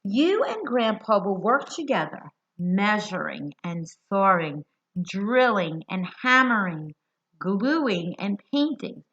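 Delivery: put-on lively storybook reading to kids; reading to children. A narrator's reading. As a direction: read it to a group of little ones, bright and clear. Reads picture book aloud.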